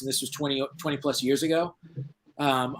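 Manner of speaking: ordinary delivery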